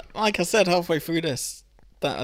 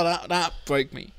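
ordinary delivery

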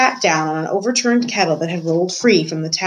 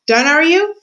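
louder, much higher-pitched question; calling out; 10 semitones higher, 5 dB louder than usual. A narrator's reading. Meg to Gregory, who has finished eating, calling out. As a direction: pipe up loudly, pitch climbing.

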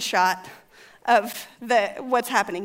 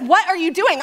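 high, loud, baffled demand; mock exasperation; 6 semitones higher, 5 dB louder than usual. Husband questioning wife's frantic search, loud and baffled. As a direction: challenge her in raised, higher voice.

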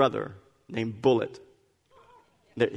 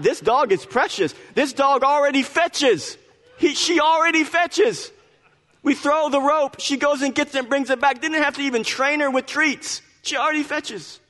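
high, bubbly delight, rising above her usual pitch; gushing about the puppy; 12 semitones higher, 3 dB louder than usual. Real, not acted. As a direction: rave about the dog like a proud owner. Gushing over the new puppy.

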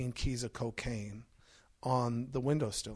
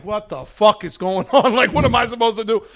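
louder, higher, laughing panic; panicked disbelief; 6 semitones higher, 12 dB louder than usual. Retold or imitated. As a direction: lose it in the chaos. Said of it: "I'm like".

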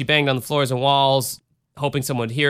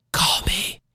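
whispered come-on; mock whisper; 4 semitones higher than usual. Podcaster to an old crush who may be listening, mock whisper. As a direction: drop into a playful whisper. Joking plea to a former date.

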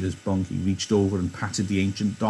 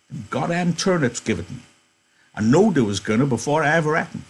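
put-on high, thin old-timer's voice; old Candy voice; 6 semitones higher than usual. Old Candy greeting Slim and George, high-pitched. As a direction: pitch up into a creaky old ranch hand. Candy complaining about turnips.

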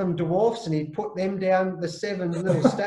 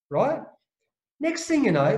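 usual speaking voice

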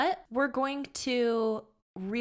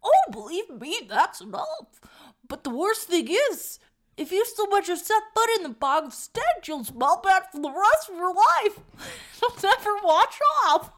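raised, loud put-on growl; growly voice; 8 semitones higher, 5 dB louder than usual. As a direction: push a cartoonish growl through it. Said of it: "It's kinda like a growl".

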